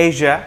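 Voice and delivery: এশিয়া said "eizha" with a জ sound; z sound in Asia. Shown as a demonstration. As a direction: voice the middle of এশিয়া as জ. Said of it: "একটা জ sound আসতেছে". (এশিয়া শব্দে জ ধ্বনি)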